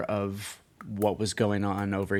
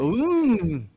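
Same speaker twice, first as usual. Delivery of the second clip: embarrassed ooh; 10 semitones higher, 6 dB louder than usual. Real, not acted. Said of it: "Sorry, please edit that out".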